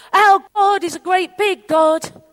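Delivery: high, slow rhythmic proclamation; hearty declaration. Retold or imitated. Preacher quoting a congregational song, hearty. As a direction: proclaim it like a praise chorus. Quotes a praise song emphatically.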